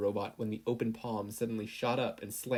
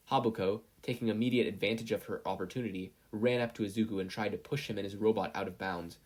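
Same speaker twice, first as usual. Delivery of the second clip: plain reading voice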